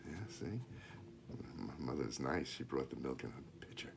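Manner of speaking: soft whispered comment on the picture; soft aside. Real, not acted. Aside to child over picture.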